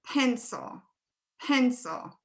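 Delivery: "pencil" slowly, with the first syllable PEN stressed. Shown as a demonstration. Teacher to class, modeling stress in "pencil". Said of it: "is the first syllable stressed".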